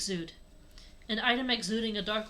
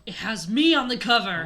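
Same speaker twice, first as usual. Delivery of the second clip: loud startled exclamation; blurting it out in shock; alarmed outburst; 9 dB louder than usual. Acted. Lucario tells Chris he is on the cover, alarmed and loud.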